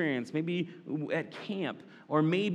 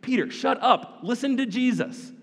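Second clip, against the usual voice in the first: higher, exasperated command; frustrated God; 8 semitones higher, 3 dB louder than usual. Acted. God the Father scolding Peter, exasperated. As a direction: scold like a fed-up parent. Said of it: "get a little frustrated with Peter".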